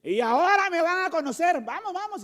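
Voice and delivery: high, taunting challenge; mock angry driver. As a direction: taunt him like a hothead driver. (conductor burlón y desafiante, agudo)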